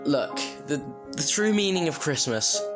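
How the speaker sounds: ordinary delivery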